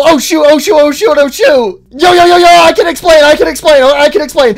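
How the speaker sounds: high, loud flustered pleading; loud, high mock-panicked babbling. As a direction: scramble to explain, hammed-up panic. Caught with wrong model, panicking.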